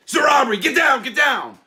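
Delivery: high, very loud robber's yell; shouted command. Retold or imitated. Robber ordering the guy to the floor, re-enacted shout. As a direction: shout it as an urgent order, full force.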